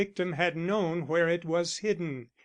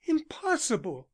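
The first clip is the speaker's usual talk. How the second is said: higher, quick dismissive "Impossible"; disbelieving Elsie; 8 semitones higher than usual. A narrator's reading. Elsie dismissing Arnold's fear, higher disbelieving "Impossible". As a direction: she waves the idea away. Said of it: "He turned on her fiercely".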